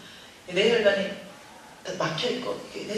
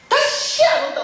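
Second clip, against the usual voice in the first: much higher, loud parting shout; angry shout; 8 dB louder than usual. Retold or imitated. Daughter leaving mother's house, furious shout. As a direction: yell it as you storm out.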